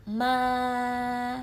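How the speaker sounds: MA sung out like a choir; "Ma" as one sustained choral note; level first tone. Shown as a demonstration. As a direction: hold MA high, long and level. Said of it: "Seperti paduan suara".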